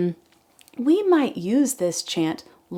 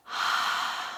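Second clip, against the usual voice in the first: slow audible breath out; long exhale; 8 dB quieter than usual. Shown as a demonstration. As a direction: breathe out with a soft sigh. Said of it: "Join me for a deep breath".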